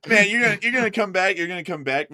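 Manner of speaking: usual speaking voice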